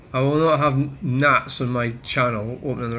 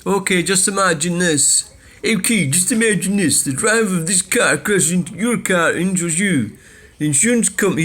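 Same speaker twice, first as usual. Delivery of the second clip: higher, smug know-it-all read; mocking Brian, pitched up; mock commenter voice; 5 semitones higher than usual. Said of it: "he says".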